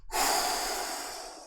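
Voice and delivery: whispered sighing breath out; breathy exhale. Shown as a demonstration. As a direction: blow the breath out audibly. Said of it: "big exhale".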